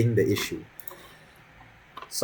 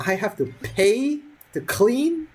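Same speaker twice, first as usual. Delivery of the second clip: incredulous; 12 semitones higher, slower than usual. Real, not acted. Incredulous rant about fines.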